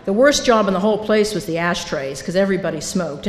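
ordinary delivery

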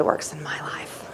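slow whispered aside about God; hushed whisper. Real, not acted. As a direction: whisper it slowly, in awe. Quiet reflection on God's work.

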